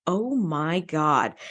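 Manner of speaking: a very slow, low, awestruck oh my God; slow awed gasp. Retold or imitated. Picard stunned by the glowing figure, very slow and awed. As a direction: let the words crawl out in wonder. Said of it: "And Picard says".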